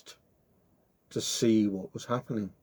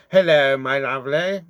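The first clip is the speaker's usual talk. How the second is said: silky, louder sly wolf greeting; 2 semitones higher, 6 dB louder than usual. Acted. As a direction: charm her like a crafty wolf. Wolf greets girl slyly.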